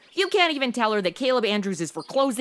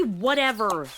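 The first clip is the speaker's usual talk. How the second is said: ordinary talk, nothing marked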